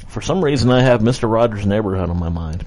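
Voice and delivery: ordinary talk, nothing marked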